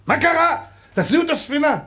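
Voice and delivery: high furious shout of a ship's captain; angry captain. Acted. Captain yelling at the stokers to throw out the coal, high furious shout. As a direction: bellow the orders like an outraged captain. Captain demands the ship move.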